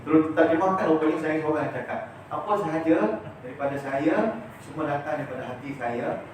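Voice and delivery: ordinary talk, nothing marked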